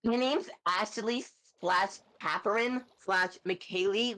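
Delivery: high ditzy airhead voice; high, bubbly bimbo character. Pretend. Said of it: "love doing my bimbo voice".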